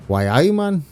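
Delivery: playful amateur Geordie impression; Geordie accent. Mock regional accent after Scouse attempt.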